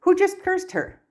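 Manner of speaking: high, startled demand; alarmed. A narrator's reading. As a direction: cry out in sudden alarm. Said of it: "looking around in alarm".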